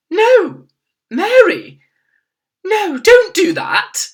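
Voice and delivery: high, loud, slow sharp order; the Queen halting her maid sharply; sharp command. A narrator's reading. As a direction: stop her firmly. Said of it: "The Queen spoke so sharply".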